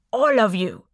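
loud, sweeping threat; angry Sultan. A narrator's reading. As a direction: fling the threat round the room. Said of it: "drowsiness replaced by anger".

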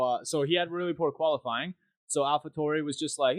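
ordinary talk

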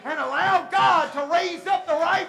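loud, rising sermon climax; full-force preaching. Real, not acted. Preacher urging the church to experience God, building to a shout.

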